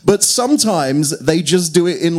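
usual speaking voice

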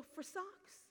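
small, high, soft voice; children's answer. Retold or imitated. Children tell woman what they prayed for, small voice.

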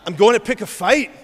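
put-on loud, comic Scottish accent; defiant mock-Scottish boast; Jesus. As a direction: declare it in a hammed-up Scottish brogue. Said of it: "responded in his Galilean Scottish accent".

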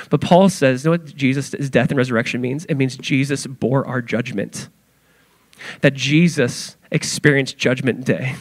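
normal talking voice

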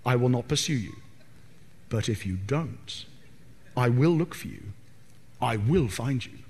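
calm threat to a kidnapper; Liam Neeson threat. Acted. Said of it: "You can imagine Liam Neeson saying it".

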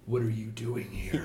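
low, quiet growling ogre; menacing ogre growl. Acted. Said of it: "glares and growls and says".

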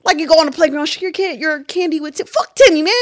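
loud, high, fired-up rant; hyped-up anger. Real, not acted. Ranting about sharing candy with Timmy, hyped anger. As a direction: get worked up over Timmy's candy. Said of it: "I got mad. Just got real hyped".

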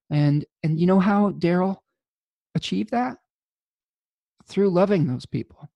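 moved, holding back tears; emotional. Real, not acted. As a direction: voice welling up with emotion. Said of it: "I'm getting emotional about it".

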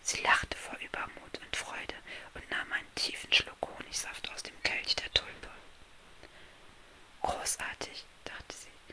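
soft unvoiced bedtime-story whisper; hushed fairy-tale narration; whispered storytelling. A narrator's reading. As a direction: whisper the tale close to the listener.

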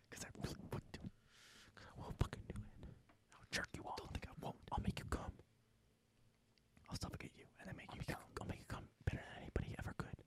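fast hushed creepy whisper. Retold or imitated. Mock creepy whispered threats.

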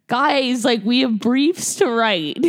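a prissy little scold; nerdy scolding. Retold or imitated. Grace acting her nerdy camp self, mock prim plea. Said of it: "and I was like".

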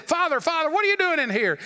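high, startled calling out to the priest; surprised call. Retold or imitated. Baron finds priest in stall.

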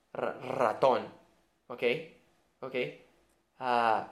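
normal talking voice

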